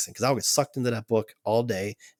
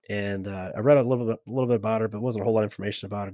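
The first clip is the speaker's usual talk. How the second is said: ordinary talk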